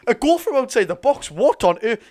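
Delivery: high, loud cry of disbelief; can't believe the goal, pitch it up high; stunned disbelief. Real, not acted. Reacting to another great goal.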